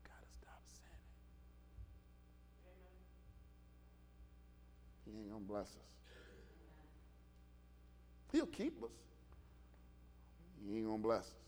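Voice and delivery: very soft, low near-whisper; barely audible worried aside; hushed muttering. Acted. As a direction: mutter it under your breath. Acts hushed worried self-talk.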